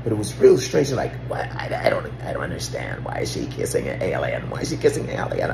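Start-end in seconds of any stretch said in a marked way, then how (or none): 1.3-3.0 low, hushed, breathy alien voice; mock alien whisper. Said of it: "Why do you sound like an alien?". 3.0-4.5 low, hushed alien take; first take, low and creepy. Retold or imitated. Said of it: "Why do you sound like an alien?". 4.5-5.5 fast, breathy, higher alien whisper; second take, quick whisper. Retold or imitated. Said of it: "Why do you sound like an alien?".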